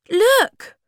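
very high, loud cry; excited shout. A narrator's reading. Sniff spots a way into the house, high shout. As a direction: yell to grab everyone's attention. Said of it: "shouted Sniff".

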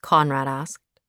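ordinary delivery, nothing marked

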